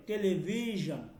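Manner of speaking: slow টেলিভিশন ending in a buzzed "zhan"; টেলিভিশন said "te-le-vee-zhan"; zh in television. Shown as a demonstration. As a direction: stretch the vee in টেলিভিশন, then zh, not sh. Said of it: "এইটার উচ্চারণটা অনেকটা এইভাবে".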